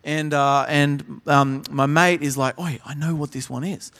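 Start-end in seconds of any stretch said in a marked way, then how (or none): 2.6-3.9 hushed classroom whisper, breathy and low. Retold or imitated. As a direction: whisper it so the teacher won't hear.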